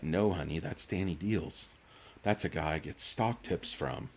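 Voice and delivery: a hint of a soothing father; dad's reply. Dad answering his little daughter, low soft mock reassurance. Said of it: "He's like".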